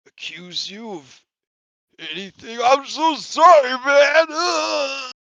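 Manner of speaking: hammed-up sobbing apology; breaking down crying. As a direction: start small, burst into a loud, high wail. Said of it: "he just starts breaking down".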